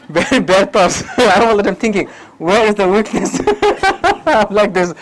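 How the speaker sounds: words spilling through giggles; amused, self-mocking chuckling; laughing confession. Real, not acted. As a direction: laugh as you admit the bad thought. Laughing at his own suspicion.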